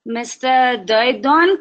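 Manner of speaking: normal talking voice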